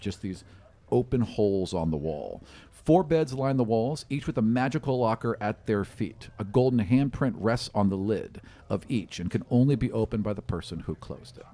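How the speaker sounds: usual speaking voice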